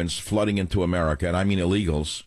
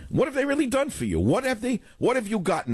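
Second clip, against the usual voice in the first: much higher-pitched repeat of the challenge; insistent rhetorical question. Real, not acted. Host challenging listeners on what the party has done, insistent and high. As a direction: press the question in a high, exasperated voice.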